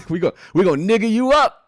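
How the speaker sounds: high, loud playful impression of the judge; hammed-up loud high teasing voice; imitating her riff. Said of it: "That's what she said".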